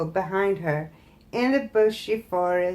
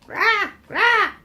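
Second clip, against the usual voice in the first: high loud crow calls; high harsh crow cries; crow croaking; 13 semitones higher, 7 dB louder than usual. Acted. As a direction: croak like an anxious old crow.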